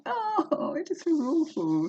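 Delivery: high, pained mock wail; dismayed quote. Vlogger mimicking her own dread at filming, high mock wail. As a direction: squeal it like it's unbearable. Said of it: "it's like".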